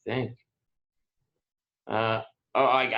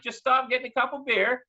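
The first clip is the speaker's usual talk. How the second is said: higher, called back casually; mild mock call back; 13 semitones higher than usual. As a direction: answer the neighbor from a distance. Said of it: "I'm like".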